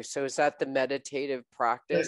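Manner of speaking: usual speaking voice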